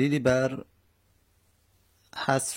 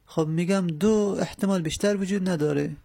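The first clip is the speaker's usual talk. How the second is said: usual speaking voice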